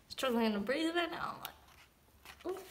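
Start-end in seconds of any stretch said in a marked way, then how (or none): none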